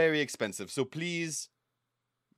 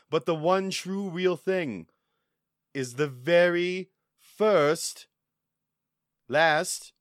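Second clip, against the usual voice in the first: slow dramatic build; 3 dB louder, slower than usual. Acted. Guide slowly builds the reveal.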